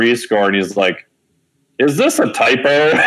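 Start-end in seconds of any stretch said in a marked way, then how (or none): 1.8-3.0 slow, high, laughing as he asks; laughing disbelief. Retold or imitated. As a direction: ask it through a laugh, half mocking. Professor sees the GRE score.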